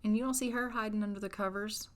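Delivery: ordinary talk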